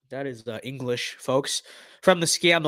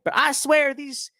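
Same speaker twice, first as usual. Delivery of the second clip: high, slow Southern drawl, hammed up; exaggerated Southern accent, high and slow; mock Southern Florida accent; 10 semitones higher than usual. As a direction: play a Florida good old boy griping about Democrats. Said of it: "That's more how Northern Floridians talk".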